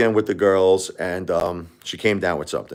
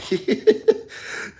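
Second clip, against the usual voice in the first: a breathless high-pitched giggle; 13 semitones higher than usual. Real, not acted. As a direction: let a sudden airy squeal of a laugh escape. Laughs at viewer's rude username.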